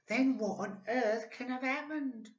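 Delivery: put-on high, squeaky worried old woman; crying Missus Twit. A narrator's reading. Frightened wife crying out, high and squeaky. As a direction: cry out in alarm like an old hag. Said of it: "cried Missus Twit".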